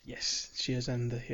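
ordinary delivery

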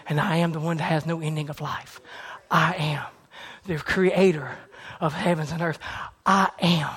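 low, soft reverent proclamation; hushed preaching. Acted. Preacher speaking softly as God to the listeners.

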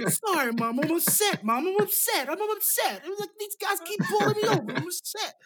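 high, whining mock son; whiny Nikki. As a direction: whine it up high like a grown son to his mom.

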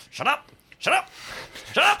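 high, urgent "Shut up!" again and again; frantic shushing yell. Acted. Alistair hushing the player in panic, high frantic yell. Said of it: "he's like".

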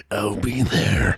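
low, slow, ominous drawl; menacing. Shown as a demonstration. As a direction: stretch it out low like a threat. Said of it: "a bit more of".

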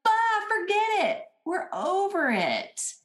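high, drawn-out mock exasperation; mock giving up. Voicing discouraged people giving up.

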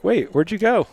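high, puzzled call out; surprised call. Retold or imitated. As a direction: call out like you lost someone. Retelling mom disappearing at bedtime.

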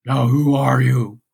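lightly voiced booming giant; a hint of a gruff one-eyed giant; Cyclops voice. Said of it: "this is my Cyclops voice".

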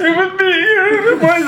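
very high, whimpering confession; mock near-crying. Scraggly pirate owning up to the boss, mock tearful. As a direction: imitate a pirate almost in tears. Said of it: "Starts crying almost".

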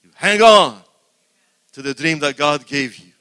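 loud preaching shout. Real, not acted. Preacher urging people to cling to their dream, shouting.

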